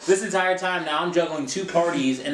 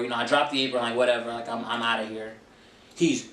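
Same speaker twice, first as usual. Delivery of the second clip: usual speaking voice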